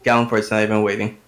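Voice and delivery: ordinary talk, nothing marked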